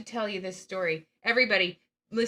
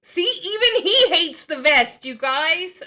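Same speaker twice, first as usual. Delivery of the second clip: loud slow mock outrage; teasing; 8 semitones higher, 11 dB louder, slower than usual. Real, not acted. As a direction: make fun of the vest loudly. Loud teasing about vest.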